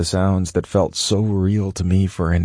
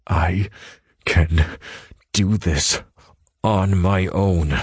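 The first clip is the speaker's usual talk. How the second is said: slow, halting, fighting the words; forcing words out through tears; slower than usual. Acted. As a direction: squeeze each word out. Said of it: "fighting to get the words out".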